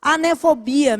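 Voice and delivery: ordinary talk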